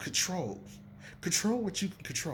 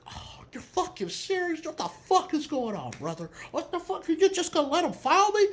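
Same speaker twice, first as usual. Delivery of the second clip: high, whiny mock protest, breathy start; imitating a complaint; 12 semitones higher than usual. Tristan complaining to the ref about fouls, mock whine. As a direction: act out a fed-up player begging the ref. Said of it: "he was like".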